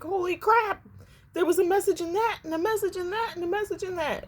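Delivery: sudden high-pitched excitement, quick at first; excited realization. Retold or imitated. Viewer realizing the cartoon hides messages, excited high voice. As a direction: blurt it out, thrilled at a discovery. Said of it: "you're like".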